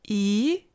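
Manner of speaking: E rising upward; second tone. Shown as a demonstration. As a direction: let E climb. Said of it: "the four tones".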